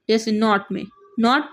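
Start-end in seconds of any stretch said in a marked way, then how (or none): 1.2-1.4 not with a brief vowel; not, vowel cut short. Shown as a demonstration. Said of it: "इन दोनों का difference करें".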